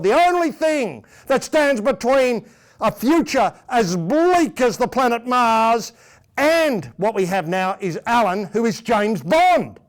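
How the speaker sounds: slow, high and grand; dramatic movie trailer. Acted. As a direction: build it up like a spy thriller trailer. Hammed-up spy thriller pitch.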